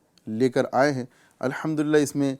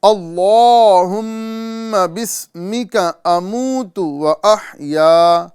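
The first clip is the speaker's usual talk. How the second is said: very slow, higher, louder chanted "اللہم بسمک اموت و احیا"; slow recitation; 5 semitones higher, 6 dB louder, much slower than usual. Shown as a demonstration. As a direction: recite each syllable stretched for students to copy. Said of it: "آپ بھی ساتھ ساتھ پڑھنے کی کوشش کریں".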